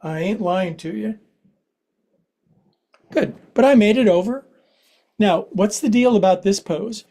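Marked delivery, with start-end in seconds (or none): 3.1-4.5 breathless triumph after effort; straining effort. Real, not acted. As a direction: push it out after a hard stretch. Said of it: "This is hard for me".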